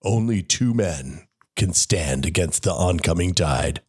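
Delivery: slow, deep trailer announcer; movie trailer voice. Pretend. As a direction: boom it out like an epic movie trailer. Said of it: "you should hang on to that".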